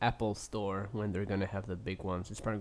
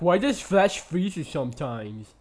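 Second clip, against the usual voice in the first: mocking dumb question; 6 semitones higher, 8 dB louder than usual. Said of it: "He's like".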